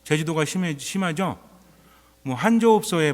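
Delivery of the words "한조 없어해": Jeju dialect phrase, higher and louder. Retold or imitated. Priest quoting Jeju islanders to the congregation, in Jeju dialect. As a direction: toss out the Jeju words with relish. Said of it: "뭐 이런 말이 있잖아요".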